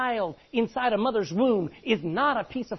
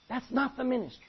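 ordinary talk

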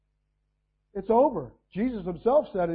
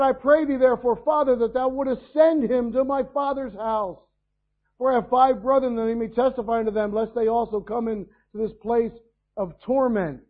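loud, raised reading of the plea; pleading rich man; 6 semitones higher, 8 dB louder than usual. Acted. Preacher reading the rich man's plea, loud. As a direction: read it like a man begging from torment.

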